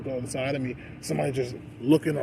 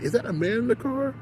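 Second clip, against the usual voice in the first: imitated gawker, voice pitched way up; mock astonishment; 12 semitones higher, 4 dB louder than usual. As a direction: act out a stunned onlooker's high question. Imitating gawkers a second time.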